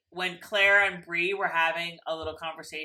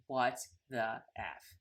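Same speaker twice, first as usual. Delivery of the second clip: slow, low, hushed disbelief; mock stunned disbelief; 5 semitones lower, 7 dB quieter, slower than usual. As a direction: spell it out slowly, softly stunned. Voices Ian watching them vanish.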